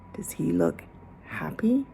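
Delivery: put-on whispered question to the children. A narrator's reading. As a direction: lean in and whisper it. Asking kids a suspense question.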